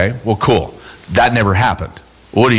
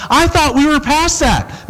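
hurt friend; 12 semitones higher than usual. Retold or imitated. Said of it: "they're like".

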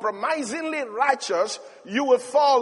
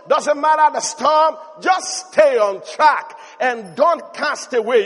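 louder, rising preacher's call; fervent preaching; 4 semitones higher, 5 dB louder than usual. Acted. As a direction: preach it out to the crowd. Preaching at full force.

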